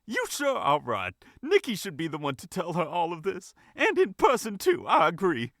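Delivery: put-on high, loud gossipy older lady. A narrator's reading. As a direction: play a chatty older lady. Annie voiced, older lady.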